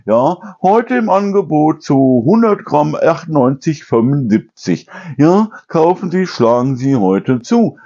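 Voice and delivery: hammed-up high announcer plugging the special offer; mock store announcement. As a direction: parody the supermarket announcer pushing a sale. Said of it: "Ja, dann diese Durchsage".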